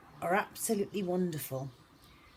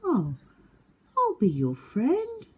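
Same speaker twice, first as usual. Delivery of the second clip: high, eager friendly offer; little beaver voice; 7 semitones higher, 8 dB louder, slower than usual. Acted. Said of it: "said Little Beaver".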